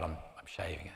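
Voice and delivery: softly whispered confession. Retold or imitated. Daughter telling dad she'll shave her head, whispered.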